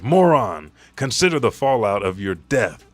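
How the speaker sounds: loud opening insult, then firm reproach; acted scolding. Ally rebuking a warrior ready to die, loud scolding. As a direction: berate a reckless comrade in character.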